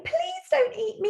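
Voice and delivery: high little goat begging; pleading. Acted. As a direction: beg in a thin, high voice. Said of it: "He's really pleading with the troll".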